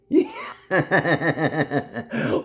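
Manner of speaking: high, rapid-fire cackle; drawn-out staccato laugh; long teasing laugh. Real, not acted. Laughing after teasing uncle.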